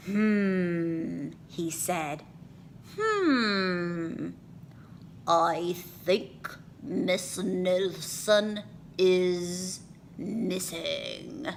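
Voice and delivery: slow, pondering detective voice; dim detective. A narrator's reading. Detective pondering kids' story, slow musing voice. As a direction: muse slowly, stating the obvious.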